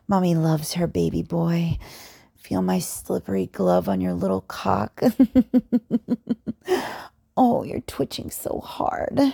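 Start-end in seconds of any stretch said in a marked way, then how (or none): 5.1-7.1 teasing laugh. Acted.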